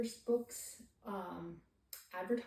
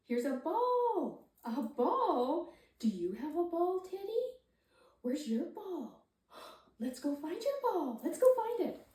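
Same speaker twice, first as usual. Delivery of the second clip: high coaxing sing-song; baby talk; 6 semitones higher, slower than usual. Shown as a demonstration. Parent reading with a baby, in high coaxing baby talk. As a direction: lead the baby gently to find the ball. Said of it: "and maybe saying".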